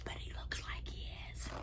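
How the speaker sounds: hushed unvoiced aside; whisper. Reader whispering an aside to the children. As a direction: whisper it like a secret. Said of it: "whispered aside by reader".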